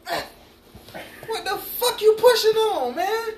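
high, loud disbelieving outburst; exasperated high-pitched holler; exasperated yell. Retold or imitated. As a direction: yell it through a laugh, incredulous. Acting out angry reaction to hard pushing.

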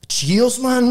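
high, bright thankful call; a lightly voiced grateful onlooker; mimicking a punter saying cheers; mock grateful cheer. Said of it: "like that".

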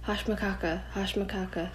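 soft, hushed calling of a name; hushed spirit voices; ghostly call. A narrator's reading. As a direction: call him like wind in the sand. Mysterious sand voices calling.

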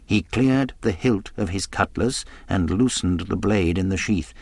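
plain reading voice

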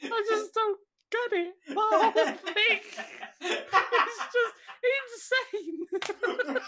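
high, laughing, stretched out insane; incredulous laughing. Acted. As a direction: squeak it out through laughter. Incredulous high mock outrage.

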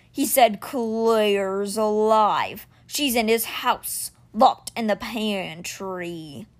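very slow, grave creature voice; measured like a great stone beast; stone cat Simber. Acted. Stone guardian bringing news to Alex, slow and grave.

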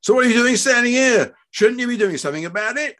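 high, loud indignant challenge; angry demand. A narrator's reading. Father rounding on the doctor, angry demand. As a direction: raise the pitch and demand action.

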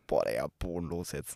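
low, muttered mock annoyance; mock annoyed. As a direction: grumble it like a mildly annoyed teen. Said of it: "Wenn ich so". (leise und genervt nachgemacht)

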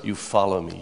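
usual speaking voice

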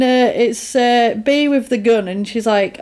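usual speaking voice

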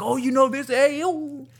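very high, put-on Italian-American exclaiming. Retelling a TikTok of Italians meeting, high hammed-up Italian-American voice. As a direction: ham up Italians discovering a shared haunt. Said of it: "Italians running into each other".

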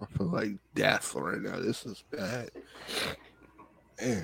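worn out and groggy, barely there; hungover. Real, not acted. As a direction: drag through it like after a rough night. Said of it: "make sure you get some sleep after this".

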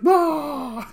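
high mock wail; imitated crying wail. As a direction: imitate a wife bursting into tears with one long wail. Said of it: "my wife just burst into tears".

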